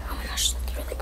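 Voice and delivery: hushed, happy whisper; relieved whisper. Real, not acted. Whispered relief over puppy.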